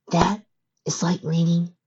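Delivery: puzzled filly voice. A narrator's reading. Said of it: "with a look of puzzlement".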